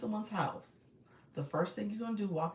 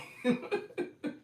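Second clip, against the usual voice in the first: breathy laugh; 11 semitones higher than usual. Real, not acted. Laughing again.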